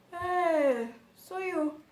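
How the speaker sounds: mock the careless doctor; imitating the doctor. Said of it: "the doctor starts speaking like this".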